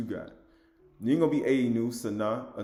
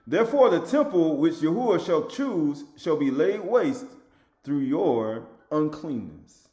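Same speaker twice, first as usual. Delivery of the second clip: slow, weighty proclamation of doom; solemn scripture reading; 2 semitones higher, 3 dB louder, slower than usual. Acted. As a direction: let each word of judgment land slowly. Quotes scripture slowly.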